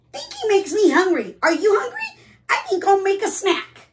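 high, loud, excitable goose. Acted. Goose announcing a snack to the bear, high goose voice. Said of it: "hear what the goose has to say".

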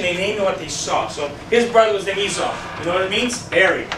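ordinary delivery

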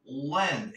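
lend, said with the tongue pulled back on the L; careful L in lend. Shown as a demonstration. Teacher showing viewers the L sound, tongue pulled back. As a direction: swallow your tongue on the L of lend. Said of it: "Esa L como si te estuvieras tragando la lengua".

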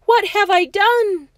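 loud, high cry of horror; aghast gasp. A narrator's reading. George yanks cotton from his mouth, gasping aghast. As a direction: gasp it out, hammed-up and appalled. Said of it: "then gasped".

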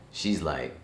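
normal talking voice

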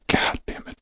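whispered, under-breath god damn it; whispered curse. Retold or imitated. Host retells reading the news feed, whispered curse. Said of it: "I'm like".

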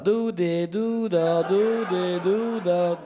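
singsong nag like a fire engine siren; mock nagging siren. Comic imitating his wife's nagging, siren-like. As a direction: chant the orders like a siren wailing.

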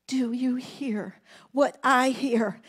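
softly asking the congregation over music; soft reprise. Real, not acted. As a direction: pull back and half-sing it softly.

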